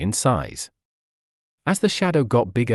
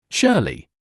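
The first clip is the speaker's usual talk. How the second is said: a light narrated scream of the name, a bit higher; mild scream; 5 semitones higher than usual. A narrator's reading. Both dogs seeing Shirley, a mild startled scream. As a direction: cry her name in alarm, lightly. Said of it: "They both screamed in unison".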